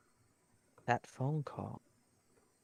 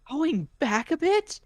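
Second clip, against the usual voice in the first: exasperated yelling; 13 semitones higher, 10 dB louder than usual. Acted. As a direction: yell it, fed up.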